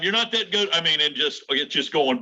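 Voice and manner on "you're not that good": mock heckling. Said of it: "trying to light him up the whole time".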